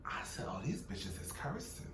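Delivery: hushed whisper aside. Real, not acted. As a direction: whisper it like gossip. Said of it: "whispered aside".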